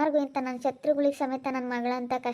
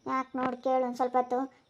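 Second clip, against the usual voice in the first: usual speaking voice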